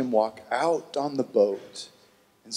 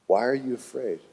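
calm Jesus; 5 semitones lower, 4 dB quieter than usual. Acted. Jesus answers the panicked disciples.

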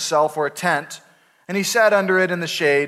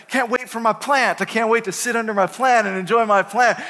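higher, eager and bright; eager Jonah; 5 semitones higher than usual. Retold or imitated. Pastor voicing Jonah waking up eager for his plant, higher. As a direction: gush about sitting under the plant. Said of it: "he's like".

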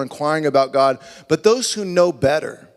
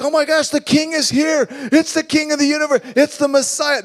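much higher, shouted wonder; excited kids; 11 semitones higher than usual. Retold or imitated. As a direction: shout it with childlike awe. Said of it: "They're like".

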